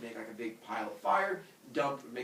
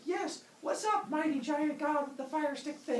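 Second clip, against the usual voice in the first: awed little humans; 13 semitones higher than usual. Acted. Humans answer mighty giant god, high comic voice.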